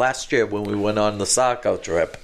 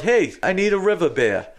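raised, higher-pitched mock holler; retold yell; 7 semitones higher than usual. Man retelling his call for a river beer, mildly yelled. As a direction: call out as if wanting a drink passed over. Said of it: "I yelled out".

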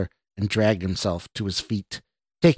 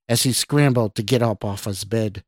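usual speaking voice

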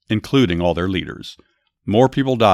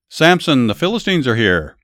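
a higher, louder warning call to Samson; mock alarmed shout; 5 semitones higher, 5 dB louder than usual. A narrator's reading. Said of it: "then shouted".

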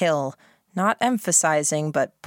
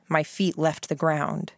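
ordinary delivery

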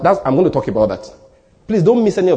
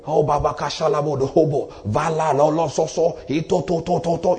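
mock praying in tongues, softer rhythmic run of syllables; imitated tongues; 5 dB quieter than usual. People praying over whether to go to America, imitated tongues. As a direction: rattle off nonsense syllables like someone praying in tongues. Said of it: "You speak all kinds of tongues".

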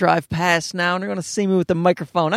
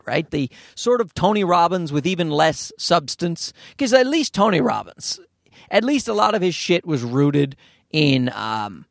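ordinary delivery

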